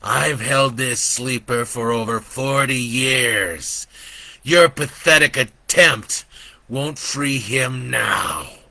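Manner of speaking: slow, menacing devil voice; boasting devil. Acted. Devil gloats to Joe, slow and menacing. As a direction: gloat over the sleeper.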